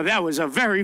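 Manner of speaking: ordinary talk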